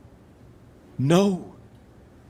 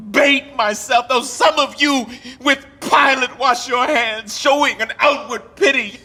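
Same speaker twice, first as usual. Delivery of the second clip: anguished outcry; 9 semitones higher than usual. Acted. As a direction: hurl it at all the onlookers. Richard accusing the watching lords.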